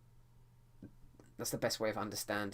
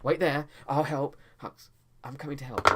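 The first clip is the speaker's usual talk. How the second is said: higher, louder urgent call; dramatic shout; 7 semitones higher, 9 dB louder than usual. Shown as a demonstration. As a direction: yell to reassure a friend. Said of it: "it needs a bit more drama".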